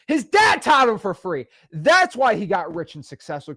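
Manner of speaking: high, loud, slow sneering mimic of a hater; loud, slow, high-pitched mock of a jealous viewer; mocking critics.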